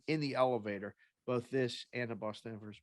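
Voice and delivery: normal talking voice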